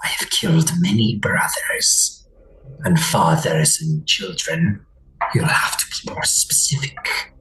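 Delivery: low, unvoiced rasp of a suspicious mind-creature; whispery alien creature. Captor confronting the Golden One, cold whisper. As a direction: hiss it out cold and accusing.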